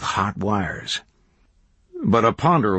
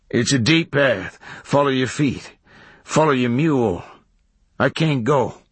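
higher-pitched, falling rustic phrases; raised country character voice; hermit giving directions; 5 semitones higher than usual. A narrator's reading. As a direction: direct the stranger like a backwoods hermit.

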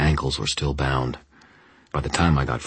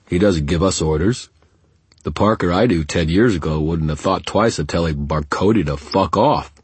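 loud, higher, defiant henchman; challenging thug; 3 semitones higher, 6 dB louder than usual. A narrator's reading. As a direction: throw a challenge at the boss, loud. Henchman challenges his boss.

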